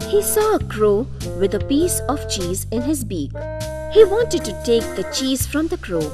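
plain reading voice

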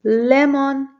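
Lemon called out loud and clear; loud model word. Shown as a demonstration. Teacher to kids, calling the word out loud. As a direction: announce Lemon to the whole class. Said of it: "Let's clap our hands when you hear".

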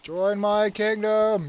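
slow, drawn-out lord's boast; slow gloating taunt. Pretend. A king gloating over conquered foes, slow and smug. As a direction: gloat over the fallen like a tyrant.

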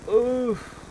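high, loud "oof"; startled oof. Real, not acted. Player takes a hit mid-game, startled oof.

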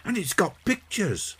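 put-on high, excited small boy; thrilled child showing off a gift; delighted cry. A narrator's reading. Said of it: "cried John".

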